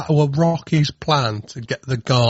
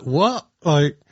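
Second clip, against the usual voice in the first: mock disbelief; 9 semitones higher than usual. Mock incredulous reaction.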